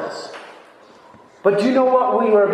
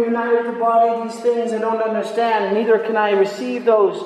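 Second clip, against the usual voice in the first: usual speaking voice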